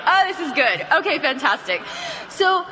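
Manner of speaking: ordinary talk, nothing marked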